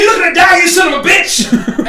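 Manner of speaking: high, loud threatening shout; angry challenge. Acted. Giant to his challenger, angry shouting. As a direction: explode at the puny challenger. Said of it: "he looks at you".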